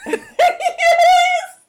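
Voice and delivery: very high, loud cheer through laughter; delighted laughing shriek. Real, not acted. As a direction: burst out in gleeful agreement. Delighted at the silly episode idea.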